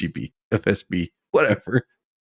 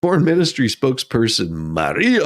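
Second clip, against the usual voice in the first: ordinary talk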